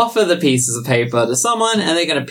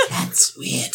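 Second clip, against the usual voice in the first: whispering snake; 7 dB quieter than usual. Acted. Snake voice continues.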